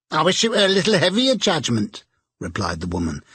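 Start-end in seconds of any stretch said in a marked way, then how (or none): 0.1-2.0 defiant charwoman. A narrator's reading. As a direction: toss it out boldly.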